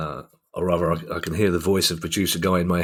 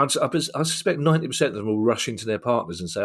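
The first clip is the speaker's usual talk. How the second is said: usual speaking voice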